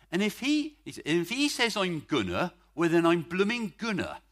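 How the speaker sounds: imitating the old youth leader's Bristol voice; a hint of West Country in his rousing line; West Country accent. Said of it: "slightly sort of unrefined English speech from the southwest of England".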